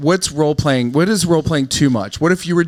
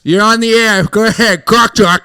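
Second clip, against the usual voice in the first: higher, loud call-in host; radio host; 5 semitones higher, 6 dB louder than usual. Acted. Man taking a mock caller on the show, loud host voice. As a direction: punch it out to the caller on air.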